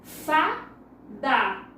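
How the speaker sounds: "Fada" split into fa... da; "Fada" in two slow beats, fa, da; syllable by syllable. Shown as a demonstration. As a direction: say "Fada" as two separate syllables with a pause between. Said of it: "Vamos separar as".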